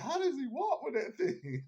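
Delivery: giggling mock girl voice; laughing Ellie impression.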